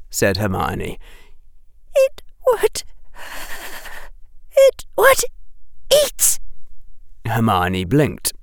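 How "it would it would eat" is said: high, tearful broken phrases; terrified faltering. A narrator's reading. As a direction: stammer it out between frightened gasps.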